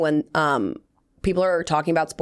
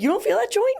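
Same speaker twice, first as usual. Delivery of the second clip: high mock quote. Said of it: "He was like".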